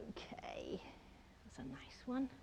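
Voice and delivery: whispered aside to herself; murmured whisper while concentrating. Real, not acted. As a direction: breathe it under your breath. Quiet aside while painting.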